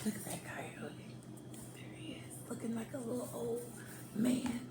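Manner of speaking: hushed whisper to the audience; conspiratorial storyteller whisper. A narrator's reading. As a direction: lean in and whisper it like a secret. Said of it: "whispered aside to listeners".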